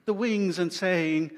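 plain reading voice

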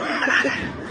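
laughing through the words. Real, not acted. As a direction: crack up as you say it. Laughing at choke the dog.

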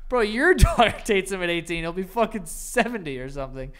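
normal talking voice

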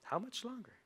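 high, very soft question; hushed appeal. Real, not acted. Preacher pressing the congregation with the question, hushed. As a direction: plead softly, almost under your breath.

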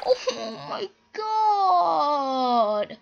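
high, hugely drawn-out wail; wailing despair. Real, not acted. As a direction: moan it out very slowly, devastated. Has just died in game.

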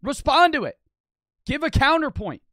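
loud, high, punched demands; exasperated demand. Real, not acted. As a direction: shout it as a challenge to your opponent. Demanding an answer from his opponent.

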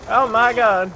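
loud high oh my god; startled. Real, not acted. Gamer startled by an enemy behind him, loud alarmed cry. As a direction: jolt of alarm.

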